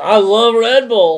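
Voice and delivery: hammed-up fan pledging love for Red Bull; mock eager. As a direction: overdo the fake brand love. Mocking sponsorship eagerness.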